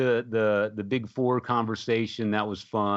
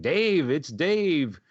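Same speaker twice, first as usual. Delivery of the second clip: high, drawn-out rock star hollering down the phone; 9 semitones higher, slower than usual. Retold or imitated. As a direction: imitate a cackling star on an answering machine. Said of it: "he's cackling on the phone".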